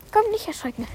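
high, soft reassuring coo; calm a startled horse softly; soothing the horse. Real, not acted. Beruhigt das Pferd. (sanftes, hohes Beruhigen des Pferdes)

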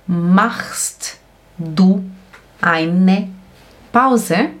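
slow, with a clear break between words. Shown as a demonstration. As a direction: dictate it slowly, pausing after each word. Professora dita palavra por palavra. (palavra por palavra, bem devagar)

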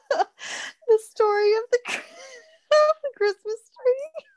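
laughing so hard it sounds like crying. Real, not acted. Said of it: "her laugh crying voice that she's using".

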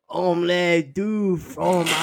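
drawn-out mock French accent; exaggerated French, stretching the vowels. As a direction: slowly ham up a French accent. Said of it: "The worst accent, French".

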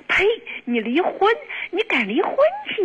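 mock incredulous dare, leaping high at the end; retold scornful challenge. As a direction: imitate an indignant mother-in-law daring you. Retold scornful dare from mother-in-law.

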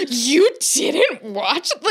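slow, drawn-out mock shock; mock disbelief. Friend imitating the other's sports-fan act, mock disbelief. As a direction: stretch it out like an appalled die-hard fan. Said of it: "pretend like you are a diehard sports fan".

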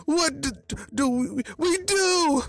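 very high, stammering shriek; scream, then stutter high in hammed-up panic; panicked scream. A narrator's reading. Hoodie flailing over missing Toby, high panicked scream. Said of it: "started flailing his arms around and screamed".

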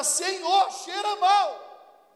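high, loud, stretched-out complaint; Marta protesting. Acted. Marta protesting to Jesus at the tomb, high and loud. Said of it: "Aí Marta".

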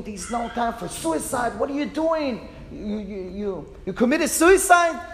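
half-done yell, rising to a high loud burst at the end; a trainer losing patience, hammed-up; retold trainer scream building to a loud high peak; mock trainer yell. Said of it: "the trainer start to scream".

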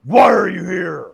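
loud, imitated monster roar of a question; charging minotaur shout. Minotaur running at him harder, loud mock shout. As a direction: shout it as an attacking beast. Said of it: "runs at me even more".

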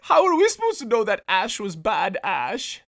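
high, far-off mock wail; high wailing neighbor. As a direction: wail it in fake innocence.

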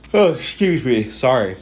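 words stretched through a real yawn. Real, not acted. Apologizing to his viewers while yawning. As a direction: excuse yourself mid-yawn. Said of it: "Sorry if I'm yawning".

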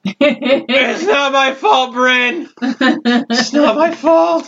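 high, very slow slurred whine; whiny drunk. Acted. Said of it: "you see Bartholomew go".

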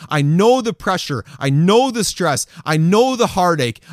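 ordinary talk

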